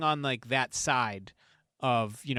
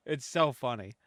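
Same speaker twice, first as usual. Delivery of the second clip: ordinary delivery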